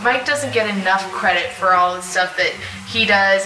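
ordinary talk, nothing marked

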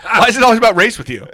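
fast, loud and high through laughter; teasing outrage, laughing hard; laughing mock accusation. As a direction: accuse him while cracking up.

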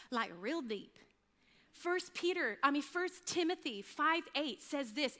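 usual speaking voice

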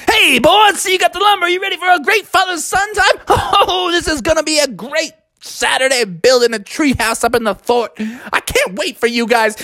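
very high, fast, chuckling salesman; pushy and overjoyed, laughing along; eager old salesman. Acted. The old salesman greets the bears, overeager and chuckling.

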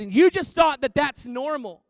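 pastor's revelation. Retold or imitated. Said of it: "And he said".

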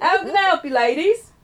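put-on high, loud English accent drifting Australian; botched English accent. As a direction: badly fake an English accent, sounding Australian. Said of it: "That was more Australian. I, you know, I can't do accents".

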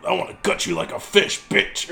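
quick, menacing killer voice; killer's threat. Retold or imitated. Retelling the killer's phone threat, quick and menacing. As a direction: growl the threat like a horror villain. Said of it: "he's like".

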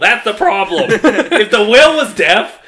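loud, high, animated protest; excited insistence. Real, not acted. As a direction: argue the point loudly. Heated whale argument.

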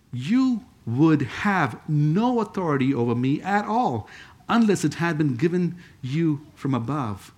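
slow, deliberate scripture reading; slow, firm reply with spaced-out words; measured answer. Acted. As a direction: weigh each phrase, unhurried. Reading Jesus' answer to Pilate.

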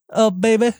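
half-hearted imitation of Liv Tyler's American accent, sighing out baby; lightly put-on American accent, teasing; bad Liv Tyler accent. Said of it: "I was terrible accent".

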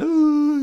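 high mock wail; one long, high pretend sob; imitated crying wail. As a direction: imitate a child wailing. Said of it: "she's crying and she's like".

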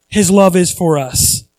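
ordinary talk

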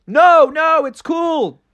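high little frog voice; high-pitched reassurance. Acted. Frog to screaming parents, high-voiced reassurance. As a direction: wave off the worry, pitched high.

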